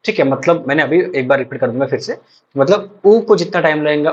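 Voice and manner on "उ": उ as a brief u; short vowel. Shown as a demonstration. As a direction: keep उ to one short beat. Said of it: "उतना ह्रस्व को time".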